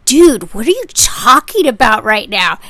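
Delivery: loud, exasperated question; annoyed seller snapping back loudly; baffled. Retold or imitated. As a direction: call out the nonsense in disbelief.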